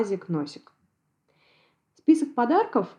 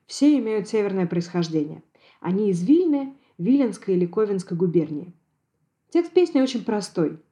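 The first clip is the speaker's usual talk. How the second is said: ordinary delivery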